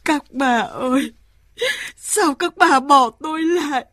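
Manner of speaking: put-on old woman mourning her departed friends, crying; tearful lament. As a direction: sob out the loneliness.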